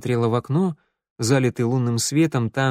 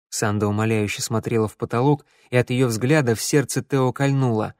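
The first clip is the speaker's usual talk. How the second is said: ordinary delivery, nothing marked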